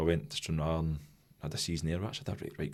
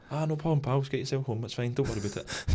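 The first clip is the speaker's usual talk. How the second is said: the manager's reply; 7 semitones higher than usual. Retold or imitated. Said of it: "me and Willie be like".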